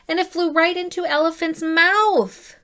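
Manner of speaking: rising excited read aloud; excited climax. A narrator's reading. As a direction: build to the surprise. Tooth lands in mouth.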